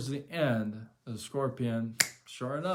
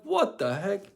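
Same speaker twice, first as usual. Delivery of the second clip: shocked outburst; 9 semitones higher, 8 dB louder than usual. Retold or imitated. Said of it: "I'm like".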